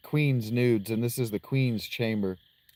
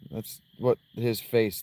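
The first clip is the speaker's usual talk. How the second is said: ordinary talk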